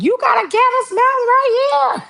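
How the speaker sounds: very high incredulous sister voice; sister's voice. Retold or imitated. Sister pointing out the candle smell, very high. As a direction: squeak it out in disbelief.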